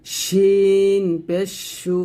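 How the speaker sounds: শিন পেশ সু drawn out; শিন stretched slowly; slow shin. Shown as a demonstration. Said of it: "letter demo". (ধীরে শিন পেশ সু)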